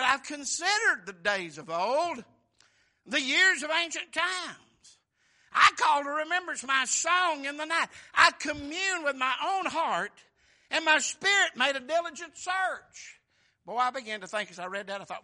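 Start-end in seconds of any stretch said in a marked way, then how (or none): 0.0-7.9 loud, slow, higher booming scripture; preaching reading. Acted. Preacher reading the psalm to his congregation, booming. 8.1-13.2 loud, higher booming scripture line; preaching reading. Acted. Preacher reading the psalmist's words to the church, loud and raised.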